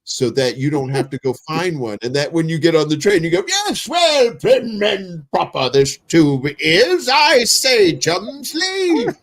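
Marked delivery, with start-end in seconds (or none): 3.5-9.2 hammed-up posh British accent, high; pompous English gent on the Tube, British accent; mock British accent. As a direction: put on a silly high British accent for the friends. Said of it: "with my British accent".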